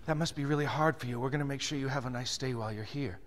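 soft, low and gentle; hushed, caring reassurance; sympathetic, matching tone. Acted. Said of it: "matches the tone and says".

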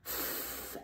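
f breathed out unvoiced as a long hiss; sound f. Shown as a demonstration. Teacher shows the sound that ph makes. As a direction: say the ph as a soft unvoiced f. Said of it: "a digraph that makes".

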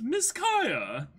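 high, thin butler voice; a prim butler greeting his young mistress; goat butler voice. Shown as a demonstration. Butler trying out his voice on Kaya, high and thin. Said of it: "what did I do the voice for the goat butler".

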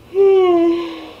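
long drawn-out yawn; big morning yawn. Real, not acted. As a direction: let out a big sleepy yawn. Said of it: "Do you guys yawn in the morning?".

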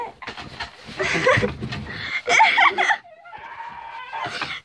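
high squealing laughter; shrieking laugh. Real, not acted.

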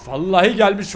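normal talking voice

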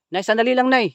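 high, loud call to stop her; urgent call. Real, not acted. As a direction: yell at her to wait. Calls old woman to wait.

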